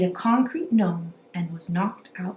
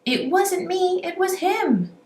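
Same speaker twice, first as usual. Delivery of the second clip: much higher whiny blame; squabbling prisoners; 11 semitones higher than usual. A narrator's reading. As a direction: point the finger, high and squeaky. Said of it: "they shout".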